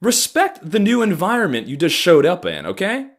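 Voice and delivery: irritated scolding. Acted. Scolds the yelling newcomer.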